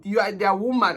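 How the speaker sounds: normal talking voice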